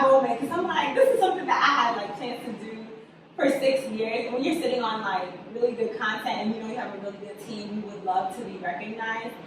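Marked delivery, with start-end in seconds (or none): none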